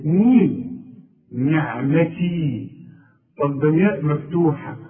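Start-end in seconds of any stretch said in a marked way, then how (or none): 0.0-2.7 مين نعمتي said slowly with a clear i on the ن; kasra on nun. Shown as a demonstration. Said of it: "بكسرها إزاي". (نطق نعمتي ببطء مع كسر النون)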